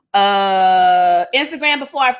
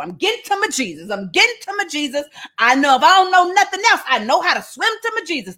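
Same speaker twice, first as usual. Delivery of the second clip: higher, eager, determined chant; eager Peter; 6 semitones higher than usual. Acted. Peter swimming to shore toward Jesus, eager and higher. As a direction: push through the water toward him.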